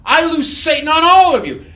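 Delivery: high, booming loosing command; shouted proclamation. Retold or imitated. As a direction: hurl it out over a panicked room. Repeats loosing proclamation at students.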